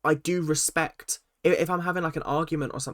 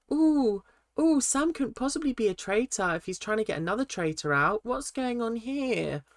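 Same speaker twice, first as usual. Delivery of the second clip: high, sing-song gullible voice, imitating; mocking voice; 7 semitones higher than usual. Host mocking players fooled by Sam, high sing-song voice. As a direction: sneer at the players who fell for it.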